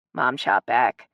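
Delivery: ordinary delivery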